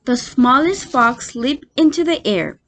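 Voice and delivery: usual speaking voice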